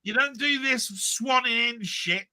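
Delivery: high, slow shouted telling-off; hammed-up rant at a latecomer; mock scolding. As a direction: hammer the point home theatrically. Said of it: "I've got a rant at Cy Robson".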